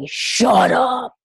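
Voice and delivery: booming voice of God; a sudden loud shout from above; thundering divine rebuke. Retold or imitated. God's voice cuts her prayer off.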